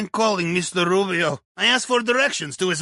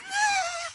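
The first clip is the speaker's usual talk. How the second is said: high burst of laughter; 6 dB quieter than usual. Acted. Laughs at his own joke.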